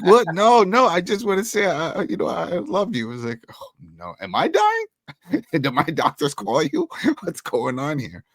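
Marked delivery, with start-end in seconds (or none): 0.0-3.1 high, loud flustered protest; mock awkward dad. Acting Tommy's awkward dad declaration. 3.5-8.2 slow, alarmed and laughing; mock alarm. Acting the son's alarmed reply.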